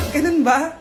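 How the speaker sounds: ordinary talk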